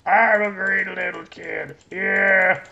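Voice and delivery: put-on loud, high, excited little-kid voice; Lucius. Birthday boy cheering for his presents, high excited kid voice. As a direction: whoop it up high and drawn out like a greedy kid. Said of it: "that's my Lucius voice".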